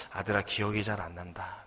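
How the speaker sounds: soft, low, near-whispered reply; meek mother. Acted. Old mother answers her angry son, meek and hushed. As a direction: quietly admit it, acting an old mother.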